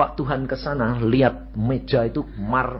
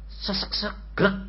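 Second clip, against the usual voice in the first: imitated choke; 8 semitones higher, 4 dB quieter, slower than usual. Shown as a demonstration. Imitating dying breath and gulp.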